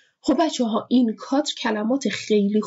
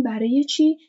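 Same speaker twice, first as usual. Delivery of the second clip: ordinary delivery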